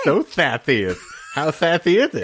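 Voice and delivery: mock the lisp with TH sounds in "Thathius". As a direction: laugh and lisp "Thathy" up high. Teasing the other's lisped name.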